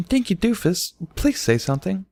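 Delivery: high small pleading; begging. A narrator's reading. Pina begging her silent friend to answer, pleading. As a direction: beg her to speak. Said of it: "Pina begged".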